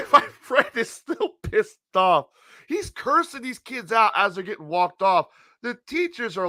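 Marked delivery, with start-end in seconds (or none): none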